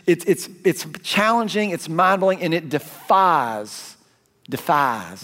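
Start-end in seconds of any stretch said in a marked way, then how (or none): 2.4-5.2 a drawled Southern "defies", stretched slow; slow Southern-accented "defies"; Southern twang. Shown as a demonstration. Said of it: "that sounds really Southern".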